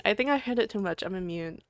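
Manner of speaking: usual speaking voice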